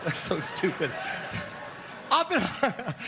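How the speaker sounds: laughing through the words. Real, not acted. Comedian tickled by his own stupid joke, laughing. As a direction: crack up at your own joke while talking.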